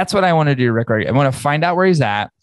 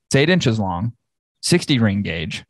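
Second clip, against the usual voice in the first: ordinary delivery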